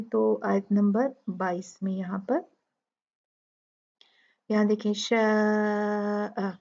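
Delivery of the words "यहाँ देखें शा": शा drawn out four counts; slow, hold the long a of शा; tajweed madd; stretched shaa. Shown as a demonstration. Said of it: "शा मद थी".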